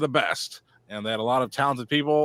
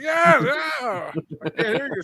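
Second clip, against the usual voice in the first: high-pitched, hammed-up imitation; mock frantic reply; 9 semitones higher, slower than usual. Co-host retelling the surrender exchange, mock high cries. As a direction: push the voice way up in mock desperation.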